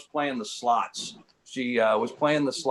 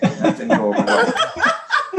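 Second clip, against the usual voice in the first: laughing; 6 dB louder, slower than usual. Real, not acted. Laughing at own punchline.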